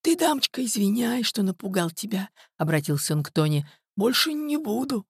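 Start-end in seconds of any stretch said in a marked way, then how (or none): 0.0-2.3 put-on high, apologetic old man's voice; servile old man. A narrator's reading. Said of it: "подобострастия на лице". 4.0-5.0 high, meek promise; servile old man. A narrator's reading. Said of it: "подобострастия на лице".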